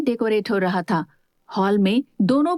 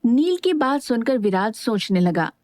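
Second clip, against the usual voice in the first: usual speaking voice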